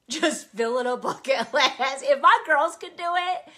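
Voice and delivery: giggling while repeating "Just filling a bucket list"; laughing. Real, not acted. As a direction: laugh through the joke at yourself. Laughs at her own joke.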